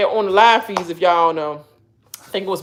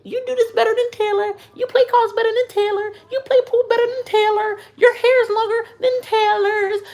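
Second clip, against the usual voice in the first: very high, gushing flattery; mock reassurance; 13 semitones higher than usual. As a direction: coo exaggerated compliments in a squeaky voice.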